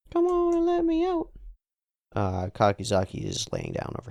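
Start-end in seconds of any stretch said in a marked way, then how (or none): 0.1-1.3 very high, squeaky demand; trapped captive. Acted. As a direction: call out in a high put-on voice. Voices trapped figure again.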